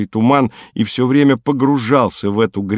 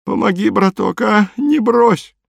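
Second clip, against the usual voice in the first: high, whining begging; moaning plea; 11 semitones higher than usual. A narrator's reading. Sinking man begging not to be abandoned, moaning plea. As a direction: beg in a high, pitiful moan. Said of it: "хрипло простонал он".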